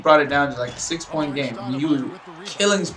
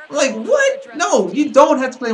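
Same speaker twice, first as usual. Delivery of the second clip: high, loud burst of disbelief; incredulous objection; 12 semitones higher, 5 dB louder than usual. Real, not acted. Fan rejecting a coach's excuse, incredulous. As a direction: protest as if the excuse is absurd.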